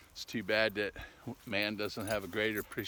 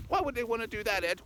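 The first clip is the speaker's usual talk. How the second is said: high put-on little voice; high-voiced questioner; 12 semitones higher, faster than usual. Sidekick asking Ed why, high put-on voice. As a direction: ask in a high, squeaky falsetto.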